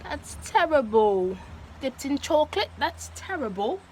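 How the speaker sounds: mock-appalled British accent; hammed-up British disapproval. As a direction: scold in a put-on British accent. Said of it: "I'm going to be speaking like a British".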